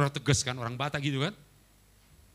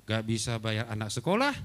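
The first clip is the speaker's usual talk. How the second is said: ordinary talk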